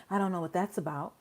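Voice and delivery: low, soft mock helplessness; imitated meek shrug of a reply; mimicking a clueless judge.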